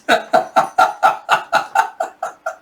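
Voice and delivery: breathless, voiceless chuckling; real helpless laughter tailing off; wheezing laugh. Real, not acted. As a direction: crack up in a string of wheezes.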